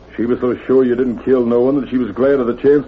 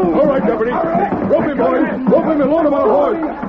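high, gleeful barked commands; triumphant orders; 12 semitones higher, slower than usual. Acted. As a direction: crow the order to rope him. Posse leader orders the capture.

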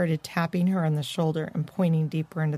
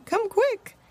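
high urgent call; crying out. A narrator's reading. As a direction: call them urgently, pitched way up. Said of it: "cried Morty".

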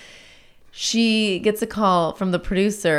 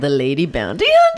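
mock announcer; 3 semitones lower, 4 dB louder than usual. Podcast host brings on the bounty hunter, mock announcer. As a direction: announce her like a star, stretching out the last word.

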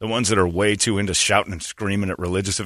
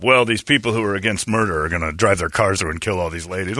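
ordinary talk, nothing marked

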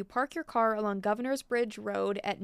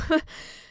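embarrassed laugh; 6 semitones higher, 4 dB louder than usual. Real, not acted. Laughs at own bad French.

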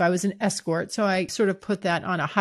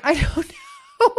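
slow, higher, giggling I don't know; laughing unsure; 8 semitones higher, slower than usual. Real, not acted. Laughing at her own price idea, slow and higher.

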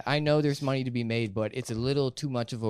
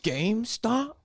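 higher-pitched, baffled comic question; Sebastian; 9 semitones higher, slower than usual. Acted. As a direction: ask it in exaggerated disbelief. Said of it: "rest of this podcast as Sebastian".

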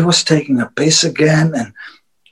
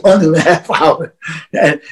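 usual speaking voice

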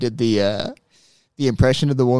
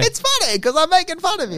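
mock voice. Mock Gervais-style retort.

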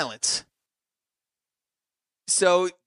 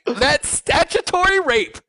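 shocked; 10 semitones higher, 4 dB louder, slower than usual. Acted. Reciting lyric in shocked tone.

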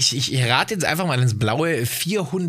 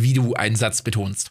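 normal talking voice

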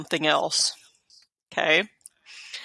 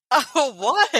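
surprised; 8 semitones higher, 5 dB louder than usual. Real, not acted. High surprised exclamation.

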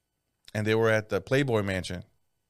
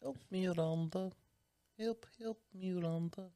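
very soft, high mock Indian accent; high, hushed Indian-accent imitation; imitated Indian accent; 7 semitones higher, 13 dB quieter than usual. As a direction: croon it quietly in a put-on Indian accent. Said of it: "He has such a good fucking accent".

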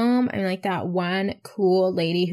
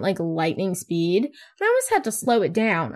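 ordinary talk

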